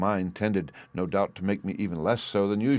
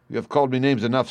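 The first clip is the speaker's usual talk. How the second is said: fast, loud, higher outburst; indignant retort; 5 semitones higher, 5 dB louder, faster than usual. A narrator's reading. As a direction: cut him off, fed up and insulted. Insulted son answers back in quarrel.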